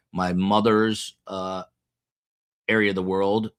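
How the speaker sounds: ordinary talk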